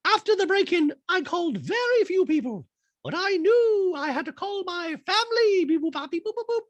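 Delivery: very high squeaky voice with beep boop robot noises; high mock robot Dorit. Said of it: "Dorit in a talking head goes".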